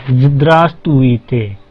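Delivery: Здравствуйте stretched very slow, ending in a fall; slow model word. Shown as a demonstration. Teacher repeating Здравствуйте slowly after spelling it. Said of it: "अकेले अकेले अक्षर पढ़िए".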